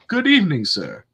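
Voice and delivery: theatrical, sing-song politeness; mock formal greeting. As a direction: imitate an overly courtly vampire hello. Retelling vampire's greeting to passers-by.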